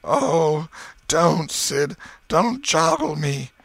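high, loud, drawn-out moan; slow, hammed-up groaning in pain. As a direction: moan as if every jolt hurts. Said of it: "Tom moaned out".

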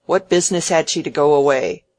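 loud, indignant complaint; impatient. A narrator's reading. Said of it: "He spoke in the same tone".

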